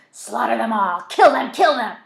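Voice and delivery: loud, bloodthirsty shouts; frenzied screaming. A narrator's reading. Voices in Zach's head urging slaughter, screaming.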